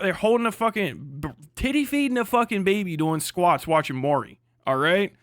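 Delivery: ordinary talk, nothing marked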